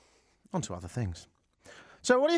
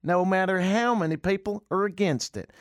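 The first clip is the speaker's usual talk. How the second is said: mock preacher; 8 semitones higher than usual. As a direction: pronounce it like a self-important pastor. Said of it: "Farias said".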